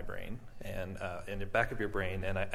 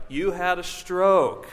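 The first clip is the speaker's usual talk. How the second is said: higher, louder, slow mock doctor voice; doctor announcing; 10 semitones higher, 9 dB louder, much slower than usual. Doctors telling the patient news, slow loud mock announcement. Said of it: "And they're like".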